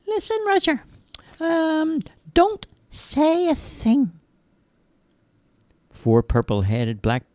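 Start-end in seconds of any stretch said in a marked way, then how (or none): 0.1-4.1 high little voice, slow and hushed; urgent squeaky caution; tiny man warning. Acted. Tiny man warns Roger among dark walls, high.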